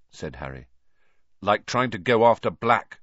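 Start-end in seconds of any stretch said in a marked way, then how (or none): 1.4-3.0 sharp, louder retort. A narrator's reading. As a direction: cut in pointedly, raised pitch. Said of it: "said Ron sharply".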